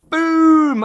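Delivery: a loud, high "boom!" burst out as a punchline; mock explosion. Vlogger caps the story of dad's cloudy beer with a loud burst. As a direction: shout the boom like something going off.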